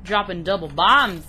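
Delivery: loud, excited call; hyped shout. Real, not acted. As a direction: shout it out with glee. Hypes up the boss fight.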